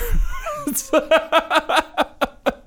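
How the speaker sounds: real helpless laughter, way up high; high squealing laugh. Real, not acted. Cracking up after telling the family cuss-word story. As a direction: burst into a high, breathless laugh.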